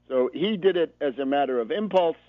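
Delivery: ordinary delivery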